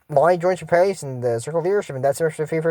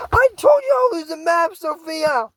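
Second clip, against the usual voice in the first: mock scolding character; 12 semitones higher, slower than usual. Kid voicing a character's scolding.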